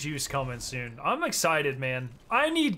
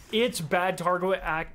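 ordinary talk, nothing marked

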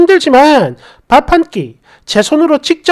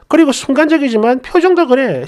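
ordinary delivery

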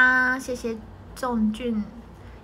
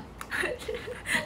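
breathless giggle; 12 semitones higher, 8 dB quieter than usual. Real, not acted. Laughing while describing mic arm.

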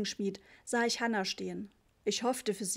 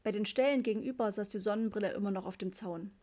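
plain reading voice

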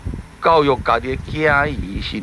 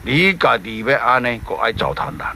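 a stern divine voice, lowered; voice of God; 5 semitones lower than usual. Acted. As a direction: pronounce judgment from above. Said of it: "上帝讲啊".

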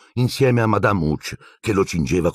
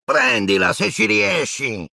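much higher, slow catch-it dare; taunting shout; 12 semitones higher, slower than usual. Acted. Malfoy shouts a dare as he throws the ball. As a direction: fling the dare at him. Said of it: "gli gridò".

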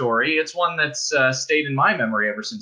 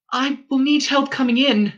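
a hint of a weary young girl, higher; lightly voiced Star; 13 semitones higher, 3 dB louder than usual. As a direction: ask for help, tired and hurt. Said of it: "said the Star".

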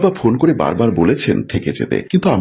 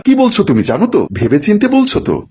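much higher, incredulous questioning; shocked disbelief; 11 semitones higher than usual. Acted. Husband confronts wife over her divorce demand, shocked. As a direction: challenge it in acted alarm.

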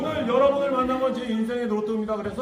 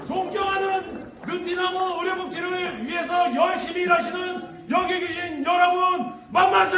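cheer leading shout; 6 semitones higher than usual. Real, not acted. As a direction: lead the crowd in a cheer. 만세 삼창을 선창함. (만세 선창 외침)

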